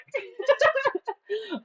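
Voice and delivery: squeaky giggle burst; high squealing laugh. Real, not acted. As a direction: let out a high-pitched giggle. Streamer laughs at her story.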